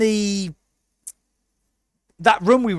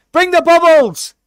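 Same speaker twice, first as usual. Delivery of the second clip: higher and louder, gleeful cry; excited shout; 11 semitones higher, 9 dB louder than usual. Real, not acted. Urging Paul to bring the bubbles, excited shout. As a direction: call it out with delight. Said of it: "Yeah, and bring the bubbles".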